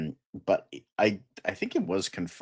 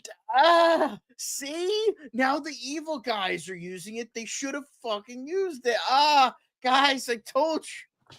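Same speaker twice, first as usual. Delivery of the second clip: very high, whining mock outrage; high-pitched hammed-up tantrum; Guy Gardner. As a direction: complain in a squeaky, strained put-on voice. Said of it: "Guy Gardner is just like".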